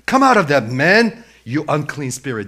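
acted stern command, easing at the end; loud command. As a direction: order the spirit out at full voice. Jesus commanding the demon, acted.

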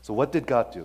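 ordinary talk

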